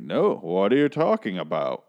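lightly imitated measured husband; Gregory West, slow and measured. Husband denies it to his wife, mock measured delivery. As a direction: deny it in a mildly put-on, deliberate voice. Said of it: "he talks very slow and measured and just like that".

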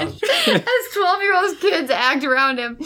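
high, slow, tearful laughter; real uncontrollable giggles; laughing. Real, not acted. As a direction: wheeze the words out, nearly crying with laughter. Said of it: "making me laugh harder".

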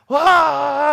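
high, loud mock shriek of panic; panicked scream. Little brother screaming in panic, loud high yelp. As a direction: yelp like a kid who thinks he hurt someone.

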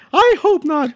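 very high, grudging cartoon falsetto; Nikki Moss. Cartoon correspondent told to stand by, grudging high falsetto. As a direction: give in with a squeaky mouse voice. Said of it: "Okay, Nikki".

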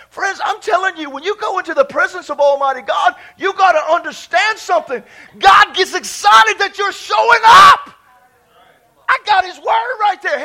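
loud, high, building preacher's shout; preaching at full force. Real, not acted. Preacher telling friends God gets excited, full-force preaching. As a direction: drive it up to a shouted peak.

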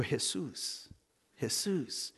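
softly offered name, said as in Spanish; gentle, hushed. Retold or imitated. Coach tells the thankful mother whom to thank, hushed and gentle.

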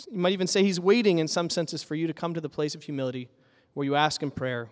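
normal talking voice

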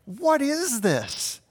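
slow, high, wondering question; hammed-up astonishment, drawn out high; amazed. The crowd in the synagogue asking in slow, high amazement. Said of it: "amazement to the point that they're questioning".

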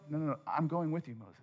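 quick, very soft reassurance; gentle reassurance. Acted. God answering Moses' worry about Pharaoh, gentle reassurance. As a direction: hush his worry softly.

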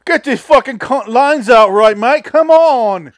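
shrill-free high cockney bark, hammed up; bossy British kid. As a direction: yell like a pushy child star at the crew. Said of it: "She's like".

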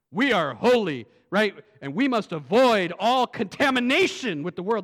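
fiery preaching. Acted. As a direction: drive the warning home to the congregation. Preaching at full force.

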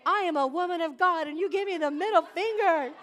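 high, loud, hammed-up righteous fury with a laugh; indignant outrage. Preacher retells yelling at the truck driver, mock furious and laughing. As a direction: play your teenage self, outraged and laughing at it. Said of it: "I was so mad".

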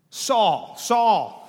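loud, attention-grabbing name call; name called high and loud, falling; urgent repeated address. Acted. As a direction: call the name out twice, emphatically. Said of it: "somebody is addressed repetitively like that".

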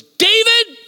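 a sudden high, loud shout of the name; yelling a name. Retold or imitated. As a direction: bang the table and call out his name. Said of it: "he yells".

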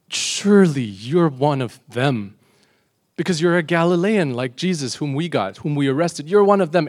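loud, pointed accusing; acted accusation. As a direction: accuse him in front of everyone. Servant accuses Peter in retold story.

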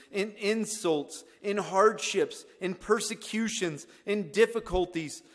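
loud, high, impassioned declamation; preaching scripture. Acted. Preacher quoting Paul on weakness to the congregation, loud and fervent. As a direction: build to full pulpit force and hold it.

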